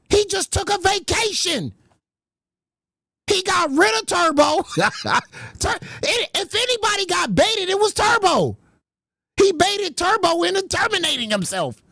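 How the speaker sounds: high, half-shouted disbelief; excited realization. Real, not acted. Host realizing Tommy C's trick, high gleeful disbelief. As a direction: build up the gleeful revelation.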